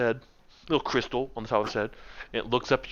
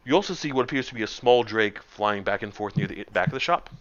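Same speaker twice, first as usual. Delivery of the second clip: usual speaking voice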